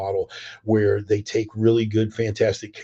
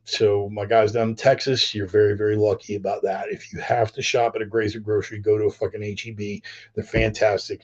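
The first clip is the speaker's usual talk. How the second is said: ordinary talk, nothing marked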